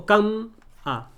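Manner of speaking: "cam-a" with held m, pause, then a; wrong syllable split. Shown as a demonstration. Teacher showing what we don't say, "cam-a" split after m. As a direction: hum the m of "cam-a" before a lone a. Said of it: "Tampoco decimos".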